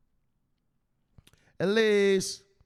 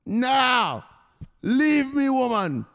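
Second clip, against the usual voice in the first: mock angry husband; 12 semitones higher, 6 dB louder than usual. [name]'s voice grows angry.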